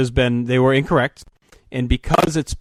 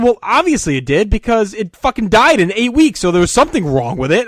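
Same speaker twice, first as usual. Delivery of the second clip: heated, higher and louder retort; angry complaint; 8 semitones higher, 4 dB louder than usual. Retold or imitated. Son arguing with the Vizio manager, angry and raised. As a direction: snap back at the defensive manager.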